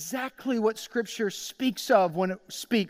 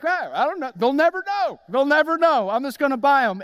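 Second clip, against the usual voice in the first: high, louder gleeful scheming; sly excitement; 5 semitones higher, 4 dB louder than usual. Retold or imitated. Shopper talking himself into fake boots, gleeful and high. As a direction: convince yourself they'll never know.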